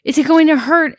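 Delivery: high-pitched mock worry; lightly acted panic, pitched well up; imitated panicky question. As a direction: mimic a daughter panicking before a shot. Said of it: "panicking and said".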